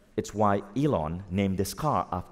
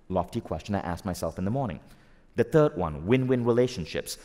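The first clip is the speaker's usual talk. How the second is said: usual speaking voice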